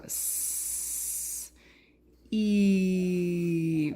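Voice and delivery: S as a long hiss, E as a long held vowel; drawn-out letter sounds. Shown as a demonstration. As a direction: sound out S and E slowly, each held. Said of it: "move your finger across and go".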